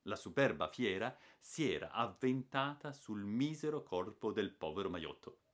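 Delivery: ordinary delivery, nothing marked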